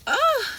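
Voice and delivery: high, loud squealed groan; retold groan of dread; exasperated "ugh". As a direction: let out an exaggerated, high-pitched ugh. Reacting to police pulling up.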